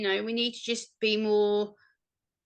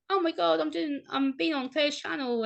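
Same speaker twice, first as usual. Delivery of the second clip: much higher giddy gush; a higher, excited burst; 6 semitones higher than usual. Retold or imitated. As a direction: squeal it like the best news ever. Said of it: "so excited waiting all day".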